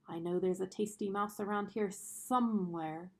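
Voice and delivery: plain reading voice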